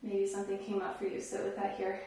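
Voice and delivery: guided meditation. Real, not acted. Yoga teacher settling students after camel pose, softly calming. Leads a seated calming rest.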